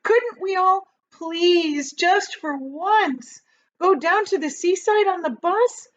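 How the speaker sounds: high, teary begging; crying child's plea; tearful pleading boy. A narrator's reading. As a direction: plead on the edge of tears. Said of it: "James cried out".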